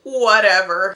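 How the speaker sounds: a loud, careless "psh, whatever"; dismissive scoff. Pretend. Vlogger shrugging off the devastating season, dismissive. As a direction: brush it off loudly. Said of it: "I'm looking back like".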